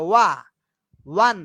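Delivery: ওয়ান said with a clear wa sound at the start; ওয়ান spelled out as wa plus n; pronunciation demo. Shown as a demonstration. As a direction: sound out ওয়া, then the full ওয়ান. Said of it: "দেখো, প্রনাউন্সিয়েশনটা কিভাবে করতেছি আমরা".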